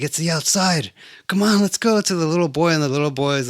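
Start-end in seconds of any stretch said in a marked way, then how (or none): 0.0-2.0 raised pitch, coaxing someone along; retold eager plea, climbing higher; acted urging. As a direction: urge them to hurry out. Acted urging of little boy.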